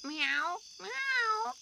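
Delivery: loud high rising meows. A narrator's reading. Narrator voicing the cat, loud high meows. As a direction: wail like a cat.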